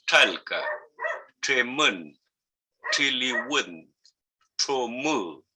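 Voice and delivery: the "tr" in "Chalca, tremón, chiliwén, chomú" burst out like a blow; explosive tr sound. Shown as a demonstration. Teacher demonstrates the Mapuzungún tr in sample words. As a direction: hit the tr as a sharp explosion. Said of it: "es un sonido, eh, explosivo como un golpe".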